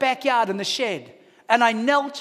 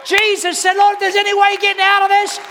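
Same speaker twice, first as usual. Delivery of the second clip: loud, high sermon storytelling; full-force preaching; 9 semitones higher, 7 dB louder than usual. Real, not acted. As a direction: preach it at the top of the voice.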